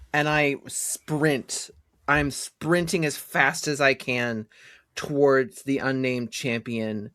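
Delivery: usual speaking voice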